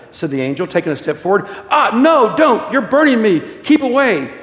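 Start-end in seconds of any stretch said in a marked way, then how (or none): 1.7-4.3 loud, high cry of alarm; acted, high-pitched pleading to keep away; panicked protest. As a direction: recoil and cry out as if being burned. Burned ghost begs angel off.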